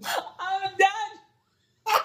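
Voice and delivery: high, breathless, cracking with laughter; laughing helplessly. Real, not acted. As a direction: laugh so hard you can't finish. After friend burps her name.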